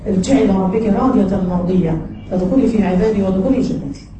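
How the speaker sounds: Quran recitation. Acted.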